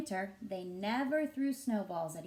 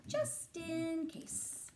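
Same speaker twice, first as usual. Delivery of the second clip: high, very slow knowing drawl; sly Lily; 7 semitones higher, 4 dB quieter, much slower than usual. Acted. Lily to the boys, slyly high and slow. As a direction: savour it like a secret. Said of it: "said Lily".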